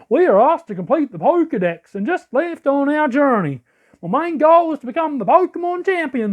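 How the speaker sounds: high, swooping put-on southern accent. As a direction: pitch up into an accidental southern accent. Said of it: "why am I doing a southern accent?".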